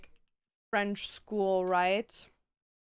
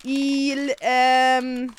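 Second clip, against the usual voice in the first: hammed-up mock French accent, louder; french chef; 3 semitones higher, 9 dB louder than usual. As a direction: ham up a fake French accent. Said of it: "I'm just making words up".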